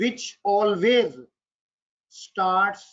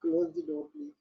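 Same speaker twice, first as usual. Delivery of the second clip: higher, softer pleading tone; gentle higher request; polite request; 6 semitones higher, 7 dB quieter than usual. Shown as a demonstration. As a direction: ask kindly, not ordering. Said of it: "Or you can request".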